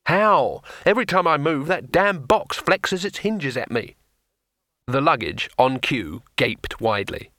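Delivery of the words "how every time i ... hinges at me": exasperated complaint. Acted.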